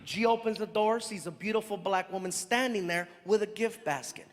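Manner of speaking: usual speaking voice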